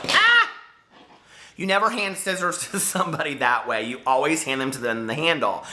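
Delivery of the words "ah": a sudden high, loud yelp; a short shocked shriek; startled yelp. Real, not acted. Startled by the scissors.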